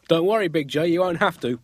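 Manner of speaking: a cheeky Judas reassuring Jesus; voicing Judas. Judas cheekily reassures Jesus at supper, mock voice. Acted Last Supper quote.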